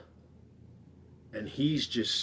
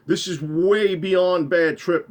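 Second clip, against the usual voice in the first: emphatic insistence; 6 semitones higher, 8 dB louder than usual. Retold or imitated. Scared narrator insists to friend.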